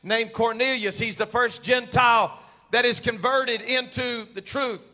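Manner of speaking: loud, rising sermon preaching. Acted. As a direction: preach it with building fire. Preaching builds to full force.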